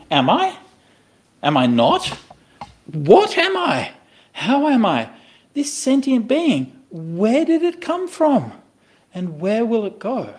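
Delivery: high, drawn-out existential wondering; acted indecision. Teacher voicing someone undecided about the present, high and wondering. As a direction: play someone baffled by their own existence. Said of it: "would you be undecided about the present".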